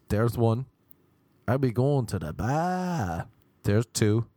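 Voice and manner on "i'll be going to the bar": slow, stretched fake Boston; bad Boston accent. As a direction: show off a poor Boston accent, dragging BAR. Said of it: "These are my accents even".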